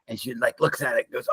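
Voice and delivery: ordinary talk, nothing marked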